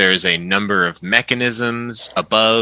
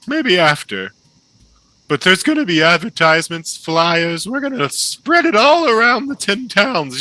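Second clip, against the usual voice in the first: flamboyant halfling; 9 semitones higher than usual. Acted. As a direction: gush like a delighted stage impresario. GM voices the halfling Mallow.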